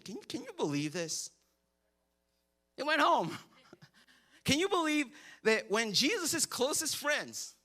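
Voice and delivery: ordinary delivery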